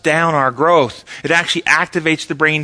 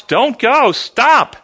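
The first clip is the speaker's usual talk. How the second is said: urgent shouted warning, pitched up; 8 semitones higher, 4 dB louder, much slower than usual. Retold or imitated. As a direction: call out like stopping someone at a cliff edge. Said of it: "he shouts".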